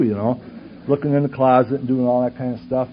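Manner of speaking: normal talking voice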